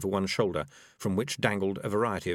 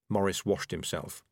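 ordinary delivery

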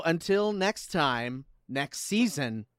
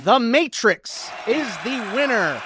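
announcer voice; 2 semitones higher than usual. Acted. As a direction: build suspense like a host. Announcing season winner.

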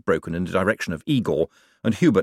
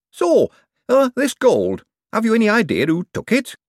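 high, louder anxious voice; nervous young man; 14 semitones higher, 5 dB louder than usual. A narrator's reading. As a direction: blurt it nervously. Hubert asks nervously.

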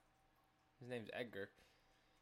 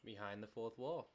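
loud, high showman's welcome; horror host; 5 semitones higher, 7 dB louder, slower than usual. Acted. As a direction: boom it out like a spooky show host. In-character show host greeting.

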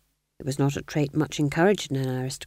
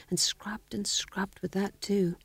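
hushed, slow whisper; 3 semitones higher, 6 dB quieter, slower than usual. A narrator's reading. Narrator hushing the Duke's bloody scrubbing: slow whisper. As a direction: breathe it out like a guilty secret.

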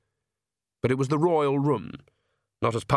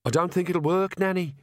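timidly bold; 7 semitones higher than usual. A narrator's reading. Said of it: "said Agnes as boldly as she dared".

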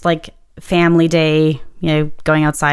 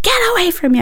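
retold scream; 13 semitones higher, faster than usual. Crash victim to the driver who hit them, retold scream at normal volume. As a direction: re-enact screaming at someone, pitch jumping up. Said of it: "I screamed at him".

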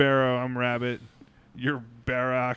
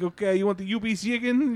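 put-on higher, garbled Mexican-accented question; thick Mexican accent; 7 semitones higher than usual. Retold worker offering chicken, thick Mexican accent. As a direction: slur "two piece" in a Mexican accent. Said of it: "the guy has a thick accent".

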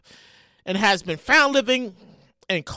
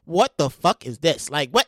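ordinary delivery

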